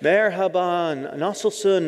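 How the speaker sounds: slow, high "Merhaba, nasılsın?" like a careful beginner; eager beginner greeting locals in Turkish. Retold or imitated. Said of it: "and I'm like".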